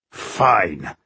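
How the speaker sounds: low, grudging growl; malevolent mutter. A narrator's reading. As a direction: mutter it with understated menace. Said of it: "Bowindil muttered malevolently".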